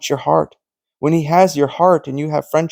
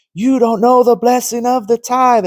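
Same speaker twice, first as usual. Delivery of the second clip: imitated yelling; 8 semitones higher than usual. Pastors yelling at him about the tithe, mock shout. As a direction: yell it back like the shouting pastors. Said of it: "they're all screaming and yelling at me".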